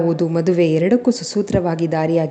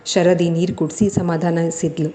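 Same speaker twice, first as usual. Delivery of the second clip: ordinary delivery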